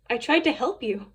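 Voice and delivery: plain reading voice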